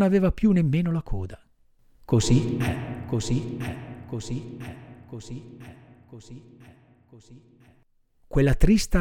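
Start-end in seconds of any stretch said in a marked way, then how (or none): 2.0-7.7 repeated, dying away to nothing; fading echo. A narrator's reading. Narrator's refrain trailing off into silence, fading. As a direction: let each repeat fade further.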